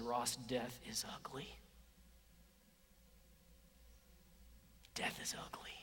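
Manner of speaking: hushed whisper, voice dropping away. Retold or imitated. Preacher quoting Jill in a whisper. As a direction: lean in and whisper it like a grave secret. Retelling friend's grief at deathbed.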